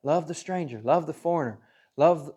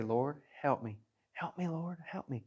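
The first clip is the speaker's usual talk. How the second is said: quiet prayer; 10 dB quieter than usual. Retold or imitated. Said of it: "you just gotta say".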